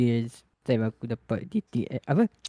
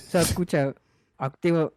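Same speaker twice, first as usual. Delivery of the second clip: ordinary delivery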